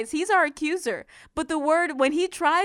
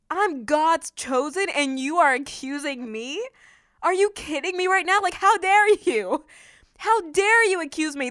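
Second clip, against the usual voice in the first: indignant challenge; 3 semitones higher than usual. Acted. God's chosen answering the enemy's accusation, indignant. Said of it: "This is what we gotta say".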